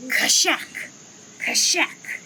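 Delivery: sharp breathy mock lemur call; lemur shouting call. Two fork-marked lemurs shouting at each other, mock lemur cries. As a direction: bark it out like a lemur in the trees. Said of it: "they shouted at each other as loud as a big branch breaking".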